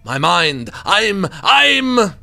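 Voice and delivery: loud, anguished French painter; French accent. Acted. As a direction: lose your mind loudly in a French accent. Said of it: "I'm not doing French".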